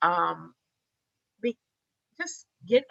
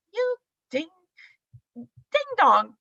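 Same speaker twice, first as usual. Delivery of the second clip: teasing scold; 9 semitones higher, 4 dB louder, slower than usual. Retold or imitated. Husband calling her clueless.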